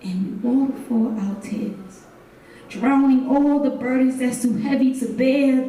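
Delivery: poem delivered with building intensity; spoken word poem. Acted. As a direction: perform it like a slam poem to a crowd.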